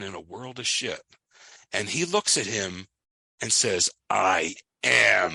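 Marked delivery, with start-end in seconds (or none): 4.1-5.3 "I am" drawn out, each word loud and separate; imitated recruit's emphatic "I am"; deliberate echo. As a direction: lean hard and slowly on "I am". Recruit's slow echo of the warning.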